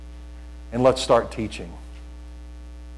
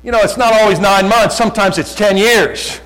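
emphatic preaching; 7 semitones higher, 5 dB louder than usual. Real, not acted. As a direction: drive it home at raised pitch. Preacher driving the point home.

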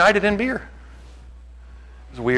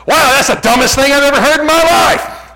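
high, loud sarcastic enthusiasm; mock-excited shout; 13 semitones higher, 10 dB louder than usual. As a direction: yell it back with exaggerated excitement. Mock-excited retort to the drinkers.

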